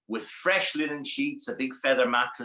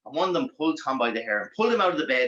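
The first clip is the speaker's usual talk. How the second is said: plain reading voice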